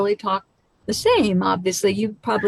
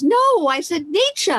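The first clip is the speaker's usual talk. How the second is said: insistent Australian; 9 semitones higher, slower than usual. Retold or imitated. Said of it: "And the woman goes".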